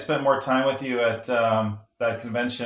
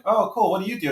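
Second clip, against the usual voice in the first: mock casual self; 7 semitones higher than usual. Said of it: "And I'm like".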